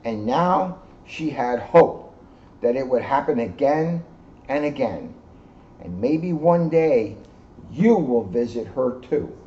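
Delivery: slow, hopeful narration; gentle read-aloud ending; storybook close. A narrator's reading. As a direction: ease the story gently to its end.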